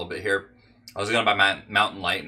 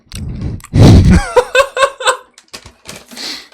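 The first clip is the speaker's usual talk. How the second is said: loud high burst of laughter; 13 dB louder than usual. Real, not acted. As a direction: crack up loudly. Laughs at his ASMR idea.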